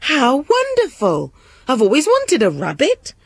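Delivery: high-pitched, delighted princess; overjoyed. A narrator's reading. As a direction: gush like a thrilled princess. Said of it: "The princess was overjoyed".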